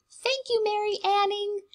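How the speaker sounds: bright, high cheer of thanks to Mary Anning; grateful thanks. Shown as a demonstration. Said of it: "you can say".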